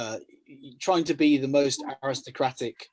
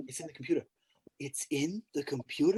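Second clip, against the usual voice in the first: puzzled film quote; 2 semitones higher, 4 dB quieter than usual. Retold or imitated. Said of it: "someone tells them".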